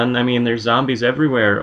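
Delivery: usual speaking voice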